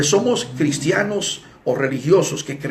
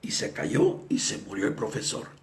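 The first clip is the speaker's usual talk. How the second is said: normal talking voice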